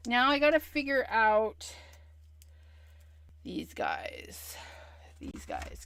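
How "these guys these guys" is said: low breathy murmur; whisper. Real, not acted. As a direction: mutter it to yourself. Muttered to herself.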